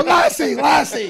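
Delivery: calling out loud and high; fans calling. Retold or imitated. Fans spotting him with the dog, calling out loudly. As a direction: shout the dog's name excitedly. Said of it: "people go".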